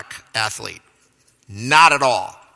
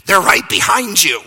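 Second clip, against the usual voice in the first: raised, urgent retold yell; mock scream; 6 semitones higher, 4 dB louder than usual. Teammates to runner on the final curve, mock screaming. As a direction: imitate teammates yelling a warning, pitched up. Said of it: "my teammates were screaming at me".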